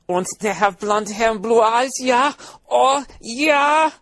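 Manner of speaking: high, excited mock German; hammed-up German accent climbing to a yell. Mock Hitler ogling blondes.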